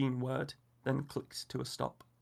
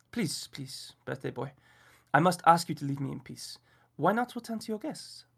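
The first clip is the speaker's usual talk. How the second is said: put-on French accent; 3 semitones higher, 4 dB louder than usual. A narrator's reading. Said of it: "a strange Frenchman".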